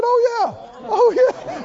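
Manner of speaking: high, loud delighted oh yeah; excited yes. Retold or imitated. Samoan men telling the preacher, excited confirmation.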